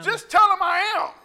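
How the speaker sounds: high, loud declaration as God; emphatic proclamation. Acted. God giving Moses his name, emphatic proclamation.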